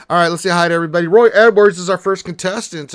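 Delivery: excited streamer welcome; loud fast hype greeting. Real, not acted. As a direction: rattle it off loud and quick.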